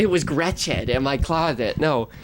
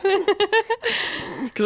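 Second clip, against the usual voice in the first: laughing; 14 semitones higher than usual. Real, not acted. Laughing high drawn voice.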